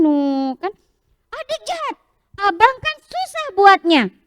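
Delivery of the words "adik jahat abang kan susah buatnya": high, sharp accusing cry of a cross big brother; angry child. Acted. Big brother scolds his sister for breaking his castle, angry and high. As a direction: snap at the little sister. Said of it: "Syabas bilang gitu".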